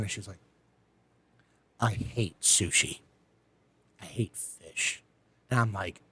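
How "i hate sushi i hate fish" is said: slow, low, hushed admission; mock miserable confession. Date confessing after spitting out sushi, slow hushed misery. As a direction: drop it low and slow, a pause between the two. Spits it out in her napkin.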